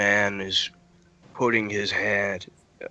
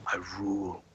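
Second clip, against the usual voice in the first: low, hushed Fey Lord, long pauses; 4 semitones lower, 9 dB quieter than usual. Acted. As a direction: declare your rule softly and low, word by word. Game master voices Fey Lord.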